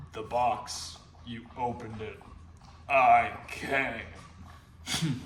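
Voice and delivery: low, soft menacing Pinhead; lightly voiced low demon. Quoting Pinhead to the audience, a low soft menace. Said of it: "O G Pinhead".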